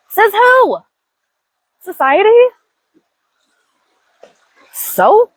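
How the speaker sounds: high, challenging questions; defiant. Real, not acted. Woman challenging society's rules, defiant. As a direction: throw it back at them.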